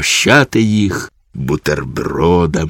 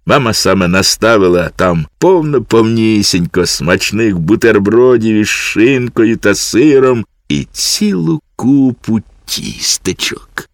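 ordinary delivery

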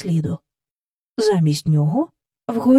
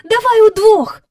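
high, louder shout; cry out; 11 semitones higher, 8 dB louder than usual. Acted. Jane calls Michael to pull together, high loud cry. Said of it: "скрикнула Джейн".

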